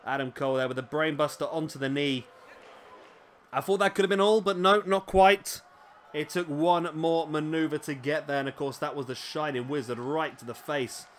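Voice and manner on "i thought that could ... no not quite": excited. Real, not acted. Near-fall call in commentary.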